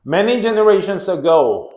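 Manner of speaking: English read out slowly and loudly, word by word; slow reading aloud. Shown as a demonstration. Reads English text aloud slowly.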